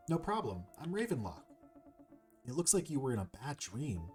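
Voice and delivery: lightly acted, obliging hero reply; lightly voiced hero. Hero introduces herself to the woken serpent, lightly acted. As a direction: play a helpful game hero, lightly.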